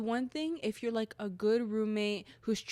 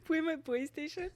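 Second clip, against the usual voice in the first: incredulous laugh; 8 semitones higher than usual. Real, not acted. Laughing disbelief at roommate's claim.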